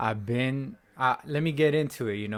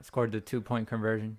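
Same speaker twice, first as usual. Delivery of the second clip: voice crack; 3 semitones lower, 7 dB quieter than usual. Real, not acted. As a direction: voice cracking mid-sentence. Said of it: "Sorry for my voice crack".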